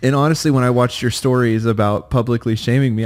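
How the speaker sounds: normal talking voice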